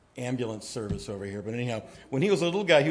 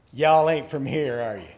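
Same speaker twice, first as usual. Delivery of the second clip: grinning Southern clerk; 6 dB louder than usual. Retold or imitated. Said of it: "with this big grin and goes".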